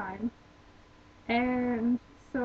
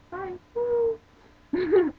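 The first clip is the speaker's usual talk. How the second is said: playful whoop; 8 semitones higher, 8 dB louder than usual. Pretend. Acts out walking straight in.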